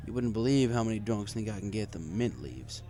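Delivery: ordinary delivery, nothing marked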